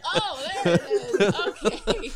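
loud, slow, laughing realization; amused realization. Retold or imitated. Sisters finally hearing Boston accents, laughing. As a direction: draw it out, laughing.

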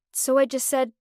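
ordinary delivery, nothing marked

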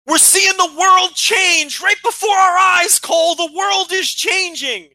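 high, loud, slow over-the-top commentator shout; imitating Corey Graves screaming. Mimicking the commentator yelling to Cole about the world. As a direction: bellow it like a wrestling announcer losing his mind. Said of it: "Corey Graves is screaming at the top of his lungs".

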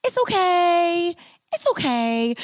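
put-on high, slow little boy saying it's okay; cute little kid voice. Imitating her friend's son soothing after spills, cute little voice. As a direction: imitate a sweet seven-year-old soothing everyone. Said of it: "he has this cute little voice".

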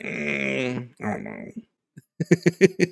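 drawn-out, reluctant hemming and hawing; soft, very slow mock hesitation; imitating doubt. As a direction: mimic someone who isn't convinced. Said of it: "So you're like".

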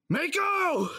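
wife shot, husband screams her name in anguish; desperate shout. Acted. Calling to his shot wife.

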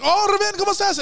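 mock burst of sudden friendliness; delighted greeting. Store manager greeting a Spanish-speaking visitor, imitated as suddenly delighted. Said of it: "he looks at me kinda, like, suspiciously".

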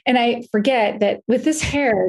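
ordinary delivery

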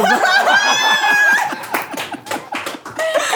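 very high, loud shrieking burst of laughter; a piercing howl of laughter at the joke. Real, not acted. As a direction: scream with laughter. Friends crack up at innuendo.

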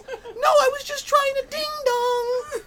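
slow, very high mock wail; desperate squealed excuse. Comedian as Larry making excuses to his wife, squealed protest.

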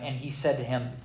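usual speaking voice

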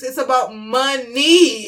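slow, drawn-out "money"; stress on money. Real, not acted. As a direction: stretch both syllables of "money" for emphasis. Hammering home follow the money.

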